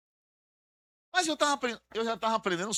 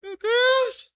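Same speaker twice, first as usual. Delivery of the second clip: mock anguished cry; 7 dB louder than usual. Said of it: "tá vivendo uma guerra".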